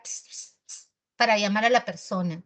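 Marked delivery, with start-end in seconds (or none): 0.0-0.9 soft hissed "psst, psst" to call someone; imitated psst. Shown as a demonstration. Teacher shows how people call someone, soft hissed pssts. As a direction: hiss quick little attention-getters, without voice. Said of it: "como el vulgar".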